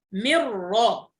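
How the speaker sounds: من را stretched slowly, nun vanishing into a doubled r; merged nun. Shown as a demonstration. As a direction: drag out من را as "merra". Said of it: "سنكتب ميم راء مشددة".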